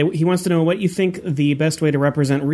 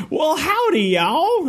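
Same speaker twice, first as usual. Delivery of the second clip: hammed-up Southern drawl, pitched much higher; mock Southern accent; 12 semitones higher, much slower than usual. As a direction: play a cowboy blundering into a samurai saga. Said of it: "wanders in and says".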